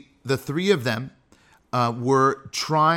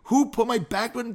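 huffy complaint; 9 semitones higher than usual. Retold or imitated. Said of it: "and get all huffy".

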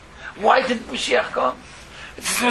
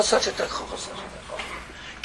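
repeat, dropping to a whisper; fading to whisper; 9 dB quieter than usual. Acted. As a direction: let it fade to breath.

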